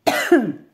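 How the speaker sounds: short hard cough; choking cough. Real, not acted. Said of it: "Je me suis vraiment étouffée".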